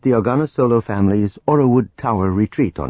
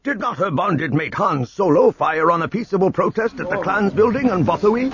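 angry heckler; 9 semitones higher than usual. A narrator's reading. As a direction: call it out with contempt. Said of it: "another angry voice called out".

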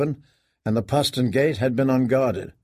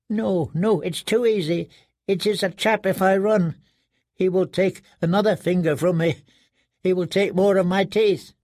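higher, dread-filled inner voice; fearful prisoner; 6 semitones higher than usual. A narrator's reading. Prisoner fearing his master's punishment, higher and fearful. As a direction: talk yourself out of it, afraid.

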